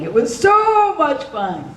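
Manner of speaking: high, drawn-out gush on "so"; gushing delight. Real, not acted. Voice actor recalling weekly group recordings, gushing.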